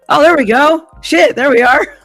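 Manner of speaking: high, loud burst of relief; excited relief. Real, not acted. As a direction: blurt out the relief. It finally works.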